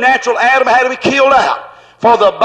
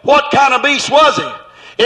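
ordinary delivery

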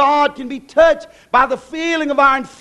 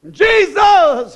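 shout; 7 semitones higher, 6 dB louder than usual. Real, not acted. Laughing shout of preacher.

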